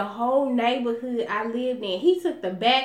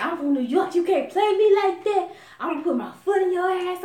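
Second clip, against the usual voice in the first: put-on boyfriend threat; 6 semitones higher than usual.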